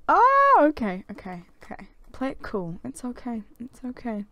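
ordinary talk

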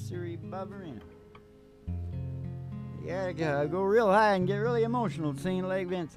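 ordinary talk, nothing marked

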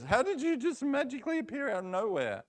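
high, fast, amazed friends; excited friends. Acted. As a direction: squeal it out as a crowd of amazed flat friends. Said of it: "all of the friends rush up and says".

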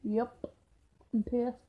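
put-on droop every word like a moody emo kid; emo person. Vlogger greeting viewers in a sullen emo voice. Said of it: "I was acting like an emo person".

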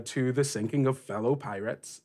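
ordinary talk

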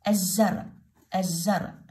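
الزرع with a doubled z and no L; silent lam. Shown as a demonstration. Teacher repeats the word for pupils, lam silent. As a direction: say الزرع as "az-zar". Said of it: "اللام ما لفظت".

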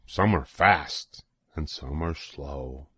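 plain reading voice

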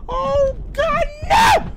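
very high, loud no; anguished scream. Real, not acted. Player seeing his rider crash, scream.

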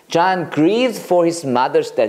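"John grieved for his mother's death" again very slowly; slow clear repeat for the class; slow teacher read; slow model sentence. Shown as a demonstration. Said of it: "그건 grieve야".

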